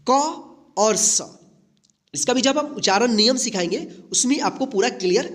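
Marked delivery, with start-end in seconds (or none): 0.1-1.3 क and स spaced out, very slow; two C sounds. Shown as a demonstration. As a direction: say क ... स very slowly. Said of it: "उच्चारण जो है ना दो है". (क और स बहुत धीरे बोलें)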